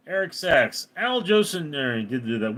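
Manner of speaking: ordinary talk, nothing marked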